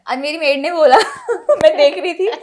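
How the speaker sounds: laughing as the story starts; amused, laughing. Real, not acted. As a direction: tell it through giggles. Amused telling of maid anecdote.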